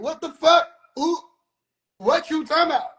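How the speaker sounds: ordinary talk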